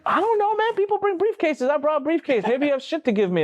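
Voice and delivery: very high, defensive voice; flustered new hire. Retold or imitated. New hire justifying his empty briefcase to the boss, flustered. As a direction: imitate someone making excuses, pitch way up.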